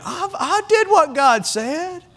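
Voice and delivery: high, slow whiny excuse. Retold or imitated. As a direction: whine it like a guilty child. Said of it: "You had to have a little whiny voice when you said that too".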